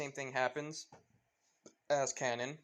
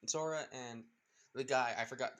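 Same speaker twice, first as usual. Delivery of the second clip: ordinary delivery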